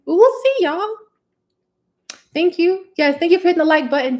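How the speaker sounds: high, loud excited squeal. Real, not acted. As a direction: squeal it happily.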